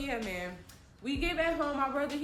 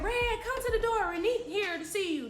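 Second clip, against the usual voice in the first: calling out; 9 semitones higher than usual. Retold or imitated. Aunt calls Red to door.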